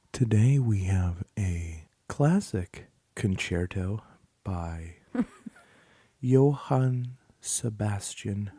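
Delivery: slow, low, hushed radio announcer; NPR announcer. Pretend. Joking to his wife about how NPR sounds, a slow low radio-host voice. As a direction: intone it like a classical station host, slowly. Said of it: "what are those channels on the radio that you, um, the N P R?".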